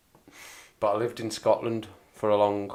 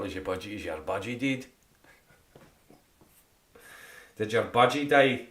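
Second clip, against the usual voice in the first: Scottish phrase. Shown as a demonstration. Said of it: "So you say budgie as well".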